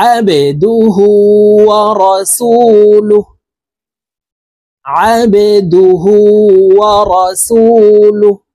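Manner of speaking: slow chanted আবেদুহু with a pushed, bouncing ba. Shown as a demonstration. Teacher showing learners the qalqalah letter in আবেদুহু, bouncing ba. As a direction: recite আবেদুহু, giving the ba a downward push. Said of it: "ধাক্কা দিয়ে উচ্চারণ".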